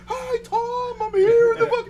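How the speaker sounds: high, loud mock field reporter shouting over the wind; high-pitched mock news reporter yelling into the wind. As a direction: ham up a weatherman in a storm. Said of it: "they're like".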